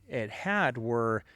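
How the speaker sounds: normal talking voice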